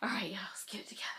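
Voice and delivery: quick, hushed self-pep-talk; tearful whisper. Real, not acted. Crying vlogger pulling it together for viewers, tearful whisper. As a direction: whisper it through real tears. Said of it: "I'm crying".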